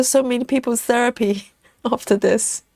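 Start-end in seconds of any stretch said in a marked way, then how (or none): none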